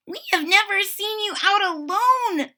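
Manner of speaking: high, squeaky little mouse voice; squeaking mice. A narrator's reading. Mice squeaking at Sarah running past. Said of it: "they squeak".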